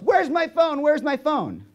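very high-pitched imitation of a yelling sister; high, frantic mock yell; mock screaming. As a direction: shriek it like an outraged sibling, hammed-up. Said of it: "Screams, yells".

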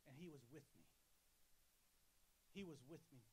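low, hushed retelling of a tearful man; mildly choked-up, subdued quote, no real sobbing; retold tearful testimony. Preacher quoting a veteran who started to cry, retold tearful testimony. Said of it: "he just started to cry".

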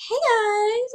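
mock imitation of own upbeat intro. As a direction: chirp it like a cheerful vlogger. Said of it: "you're usually like".

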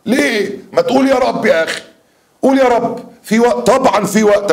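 rally them like a preacher; fervent urging. Real, not acted.

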